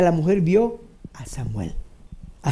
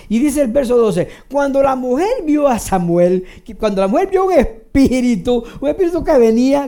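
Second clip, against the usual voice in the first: ordinary talk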